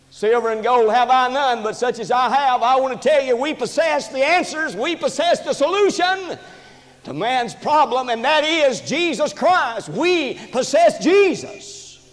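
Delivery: rising, high-pitched pulpit declaration; impassioned preaching. Real, not acted. Preacher declaring to church that they possess the answers, impassioned. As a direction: preach it with mounting fervor.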